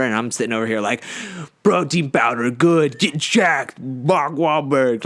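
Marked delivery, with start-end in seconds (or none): 1.6-5.0 mock gullible consumer. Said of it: "I'm the sheeple".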